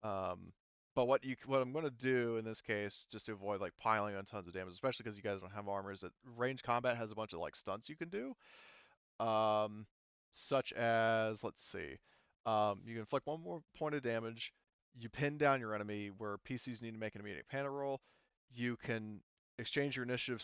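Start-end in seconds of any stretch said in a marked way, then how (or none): none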